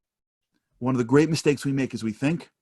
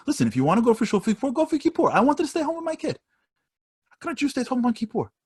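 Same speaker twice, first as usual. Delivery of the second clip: much higher, quick, protesting wife; wife's voice; 11 semitones higher than usual. Retold or imitated. Husband retells his wife protesting to her friend, in a much higher voice. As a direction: pitch it way up as the flustered wife.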